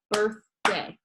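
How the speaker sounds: "birthday" slowly in two separate beats; split into syllables. Shown as a demonstration. Teacher shows class clapping syllables, "birthday" split in two. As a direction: clap out "birthday" with a gap between birth and day. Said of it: "one of them is clapping".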